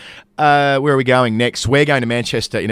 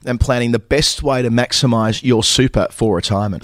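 scripted ad read. Acted. Hosts act out sponsor ad read.

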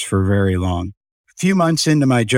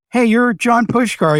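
pitched high and slowed, a friendly executive's hello; cheery older exec greeting; 10 semitones higher than usual. Retold or imitated. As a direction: greet him like a delighted senior boss, drawn out. Dr. Brown greets him warmly.

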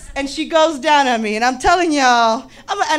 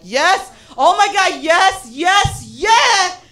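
higher, ecstatic yeses ending in a shout; 7 semitones higher, slower than usual. Retold or imitated. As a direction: build the yeses to a cry. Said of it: "I'm like".

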